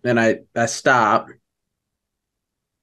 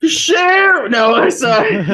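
high, loud call; shouting; 7 dB louder than usual. Acted. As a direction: yell it out across the camp. Calling out in character.

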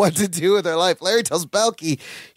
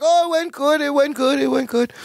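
much higher, jittery repeated reassurance; nervous Balky; 8 semitones higher than usual. Retold or imitated. Balky fibbing to Larry, nervous high voice. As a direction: cover the bad news with a nervous high chirp. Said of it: "Balky's like".

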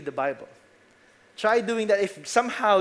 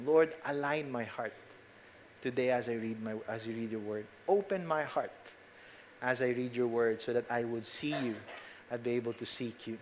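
quiet prayer; 7 semitones lower, 9 dB quieter than usual. Shown as a demonstration.

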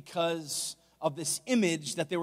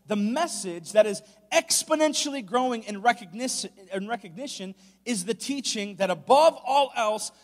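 raised, higher preaching voice building in force; emphatic preaching; 5 semitones higher, 6 dB louder than usual. Acted. Preacher warning a congregation about a popular gospel, emphatic preaching. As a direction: preach it louder, stretching out the big phrases.

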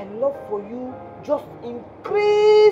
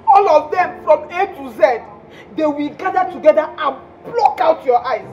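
venomous cursing; 3 dB louder than usual. Acted. Jilted caller cursing Joseph abroad, loud venomous outbursts. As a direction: hurl each curse separately, with pauses between. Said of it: "why are you spitting so much venom".

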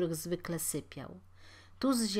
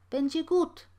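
cheerful; 10 semitones higher, 6 dB louder than usual. Acted. Landlord's Yiddish-tinged reassurance.

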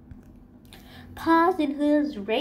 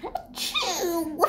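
sneeze; 5 semitones higher than usual. A narrator's reading. Character sneezes.

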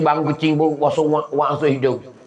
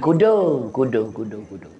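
trailing echo effect; fading echo; 5 semitones lower than usual. Acted. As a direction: imitate an echo dying away. Echoes the word, fading away.

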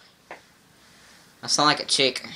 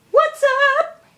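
squeaky high greeting. Acted. Greeting through voice app.

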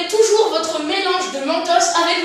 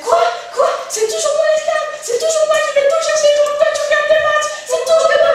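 much higher, louder nagging wife; shrieking complaint; 12 semitones higher, 8 dB louder than usual. Acted. Wife fed up with fetching drinks, high angry shout. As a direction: screech your complaint at him.

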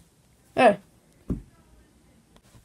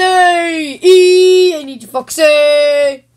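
summoning call; 6 semitones higher, 10 dB louder, much slower than usual. Acted. Summoning Foxy loudly.

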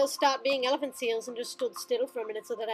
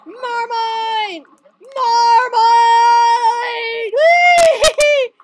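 high, loud gleeful screams; screaming; 9 semitones higher, 14 dB louder, much slower than usual. Real, not acted. Gamer screams at the shark rampage, high and laughing. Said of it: "I don't know why I screamed that".

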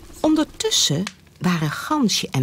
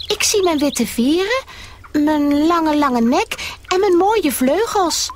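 high, pleased goose voice; admiring herself in the pond; high goose voice, proud; proud little goose; 11 semitones higher than usual. Acted.

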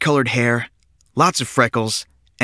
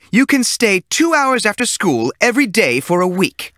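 stern teacher; 10 semitones higher than usual. A narrator's reading. Teacher offers punishment choice.

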